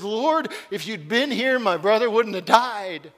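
grieving blame in her voice; accusing, heartbroken plea; recriminating. Acted. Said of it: "Can you hear the recrimination in her voice?".

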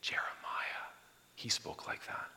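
hushed, breathy whisper; whispered aside. Retold or imitated. Crowd whispering about Jeremiah, unvoiced hush. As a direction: lean in and whisper it. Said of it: "And they go".

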